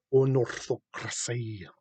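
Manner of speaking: put-on "Unorthocracy" drawn out slowly, Elvish accent. Host savouring the name in a slow Elvish accent. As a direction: stretch "Unorthocracy" out like an elf lord. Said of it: "like, an Elvish accent".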